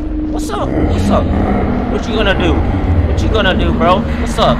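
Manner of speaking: high loud taunting; taunting yell. Real, not acted. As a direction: challenge the witch loudly. Taunting the witch.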